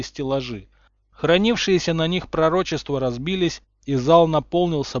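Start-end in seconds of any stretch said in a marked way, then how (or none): none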